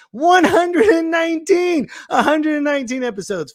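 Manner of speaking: high, sung-out mock announcement; show announcer. Host re-announcing the episode number, hyped announcer.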